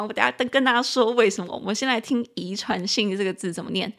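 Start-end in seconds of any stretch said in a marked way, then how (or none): none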